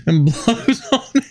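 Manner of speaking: high squealing laugh through the words; cracking up while telling it; giggling high-pitched retelling. Retold or imitated. Acting out whistle blowing.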